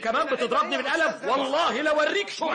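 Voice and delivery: put-on young man shouting back in a street fight; angry outburst. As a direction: flare up at the man who slapped you. Street quarrel after a slap.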